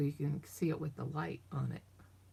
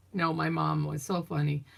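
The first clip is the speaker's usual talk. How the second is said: ordinary talk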